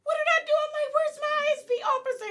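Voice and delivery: high, loud protest; panicked yelling. Retold or imitated. Retelling police cuffing her at the door, panicked yelling. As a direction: yell in alarm as if being cuffed. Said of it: "I'm like yelling this shit".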